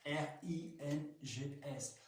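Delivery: slowly, letter by letter; spelled out. Shown as a demonstration. Spelling the toy's name for viewers, slow and letter by letter. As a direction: spell it out in French letters, spaced apart. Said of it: "ça s'écrit".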